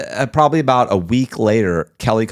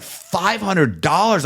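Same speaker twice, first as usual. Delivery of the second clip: slow, drawn-out, much higher disbelieving question; shocked disbelief; 10 semitones higher, much slower than usual. Retold or imitated. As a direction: stretch it out in stunned disbelief. Retelling shock at Eric's price.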